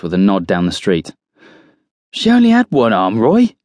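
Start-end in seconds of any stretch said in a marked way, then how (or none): 2.1-3.6 high-pitched, louder teasing; incredulous amusement without laughing; amused. A narrator's reading. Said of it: "Cookie laughs".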